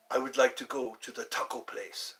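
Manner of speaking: a lightly put-on Latin voice. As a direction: say it in a mild Latin accent. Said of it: "I'll even change my voice for you".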